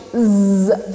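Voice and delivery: a buzzing, vibrating "Z"; buzzing z sound. Shown as a demonstration. Teacher showing the vibrating "Z" sound. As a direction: buzz the "Z". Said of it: "the sound is like z, some kind of vibration".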